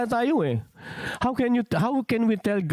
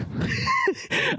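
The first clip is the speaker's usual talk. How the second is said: a sudden high laugh at his own slip; high burst of laughter. Real, not acted. Preacher laughing at his own slip to the church, high burst of laughter.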